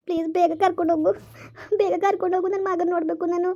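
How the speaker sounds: high, tearful begging; crying plea. Acted. Mother begging to be taken to her son, crying. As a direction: plead urgently through sobs. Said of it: "ಅಳ್ಬೇಡ ಸುಮ್ನಿರು".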